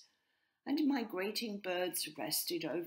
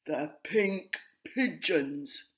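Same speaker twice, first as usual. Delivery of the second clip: pigeon voice; 6 dB louder, much slower than usual. A narrator's reading. Po the grey pigeon speaking.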